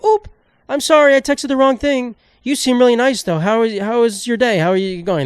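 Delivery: high-pitched fake apology into small talk; mock scam texter. Scammer pretending a wrong number, mock high voice. Voicing scammer's follow-up texts.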